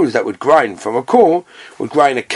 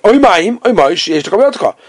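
high, louder cry of oy Mayim; hammed-up demanding creditor; mock demand; 8 semitones higher, 5 dB louder than usual. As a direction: call out Oy Mayim like a creditor chasing his debt. Creditor demanding his water back.

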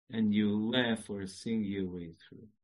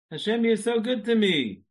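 higher and louder, drawn out; said slow; 10 semitones higher, 8 dB louder than usual. Shown as a demonstration. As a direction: stretch each word with fervor. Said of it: "I'll say it slow".

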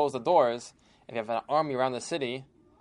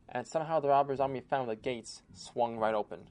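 plain reading voice

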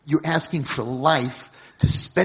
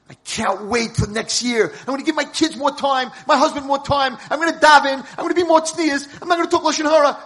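eager resolutions; 11 semitones higher, 9 dB louder than usual. Acted. Women's hopes before Hashem.

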